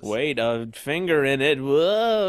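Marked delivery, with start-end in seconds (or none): 1.6-2.3 spooked and shaky. Pretend. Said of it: "That's my impression of a spooked person".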